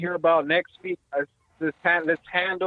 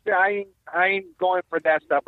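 usual speaking voice

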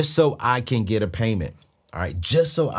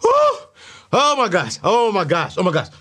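breathless exclamation; 8 semitones higher, 5 dB louder than usual. Real, not acted. Reacting to her own rant.

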